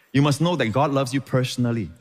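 normal talking voice

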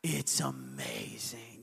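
put-on low, soft awed old man's voice; hushed old man. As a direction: drop low and slow in wonder. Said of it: "And he goes".

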